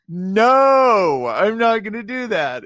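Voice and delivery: high, loud retold refusal; mock protest. Man refusing an absurd divine command.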